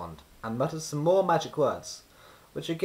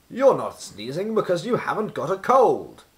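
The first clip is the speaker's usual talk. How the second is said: put-on booming old wizard voice, higher and louder; wise old wizard; 6 semitones higher, 8 dB louder than usual. A narrator's reading. Wizard answering from behind Mister Sneeze, booming. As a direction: speak up like a grand old wizard.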